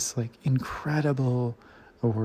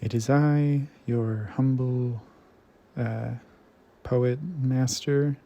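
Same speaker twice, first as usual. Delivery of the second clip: mock grandiose greeting. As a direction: announce yourself like a pompous bard, half joking. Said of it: "that felt gross in an uncomfortable way to say".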